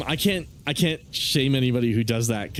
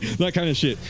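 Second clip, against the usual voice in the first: ordinary talk, nothing marked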